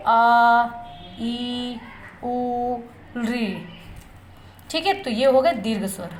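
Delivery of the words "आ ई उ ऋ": आ, ई, उ, ऋ each drawn out long and slow, mouth wide open; long vowel demo. Shown as a demonstration. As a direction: stretch every vowel to twice its length. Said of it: "आप भी उच्चारण करेंगे". (हर दीर्घ स्वर धीरे और खींचकर, मुँह पूरा खोलकर)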